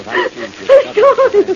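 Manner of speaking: high, shocked outburst; terrified. Acted. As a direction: blurt it out in terror. Terrified after seeing the man.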